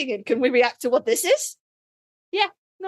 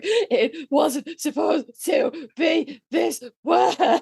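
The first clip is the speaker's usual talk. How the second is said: slow, drawn-out mock sob; mock sobbing lament; 3 semitones higher, much slower than usual. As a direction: stretch each word out like a fake weeping fan. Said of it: "just sobbing for".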